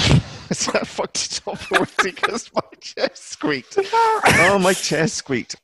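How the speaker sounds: high-pitched, laughing through the words; laughing outburst. Real, not acted. Host botches the intro over a squeaky chair, laughing high. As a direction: crack up at your own blown take.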